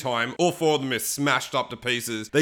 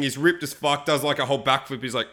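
ordinary delivery

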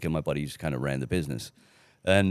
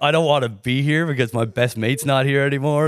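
loud retort; 7 semitones higher, 6 dB louder than usual. Retold or imitated. Arguing back with boss.

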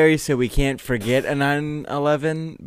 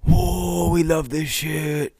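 high, drawn-out gleeful whoop; be a fire cheering itself on; long high whoa, stretched-out words; 6 semitones higher, much slower than usual. Acted. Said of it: "the fire is just like".

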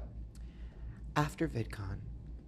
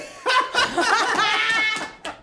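high shrieking laugh; 5 dB louder than usual. Real, not acted. Laughs after money joke.

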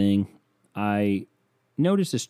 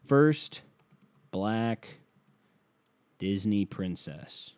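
ordinary delivery

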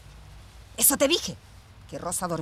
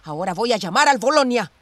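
building from firm to a loud threat; angry threat; 7 dB louder than usual. Acted. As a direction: threaten her, getting louder. Sisters quarrelling, threat to leave.

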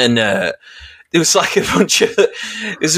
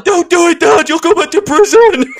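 put-on high, loud begging children; mock pleading kids; 5 dB louder than usual. Kids holding dad's legs at football, high loud pleading. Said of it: "they're like".

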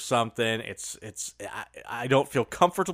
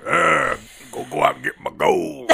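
grizzled prospector. Pretend. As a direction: growl it like an old gold miner. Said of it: "Talk Like a Grizzled Prospector Day".

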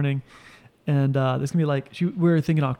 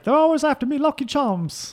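very high cartoon Irish lilt; Irish accent; 11 semitones higher than usual. Friend showing an Irish accent with cereal line, high mock Irish. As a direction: ham up a leprechaun-style Irish accent. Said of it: "how do you do an Irish accent".

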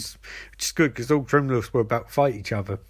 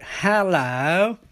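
higher, drawn-out hello; mock villain greeting; 5 semitones higher than usual. Villain appearing at the entrance, hammed-up hello. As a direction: stretch the greeting in a put-on voice.

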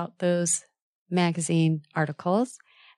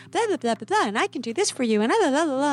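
higher, rushed blah-blah of nonstop chatter; nervous rambling sent up in a high voice; mock babbling; 7 semitones higher, faster than usual. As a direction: parody yourself talking on and on. And I'm just like.

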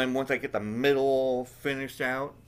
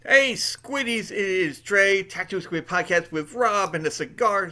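excited host greeting; 7 semitones higher, 4 dB louder than usual. Real, not acted.

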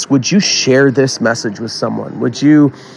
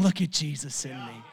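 hushed and reverent; 16 dB quieter than usual. Acted. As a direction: draw them close to hear. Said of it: "He was saying".

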